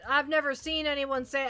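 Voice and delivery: normal talking voice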